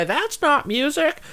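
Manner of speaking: put-on high, slow grumpy old man voice. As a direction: play a cranky old guy. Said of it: "I feel like that old guy".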